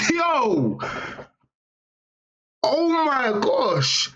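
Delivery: high, slow drawn-out gasp of shock; real, high-pitched, slow "oh my gosh"; stunned disbelief. Real, not acted. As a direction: let the shock stretch the words out. Reacting to lizard cage photo.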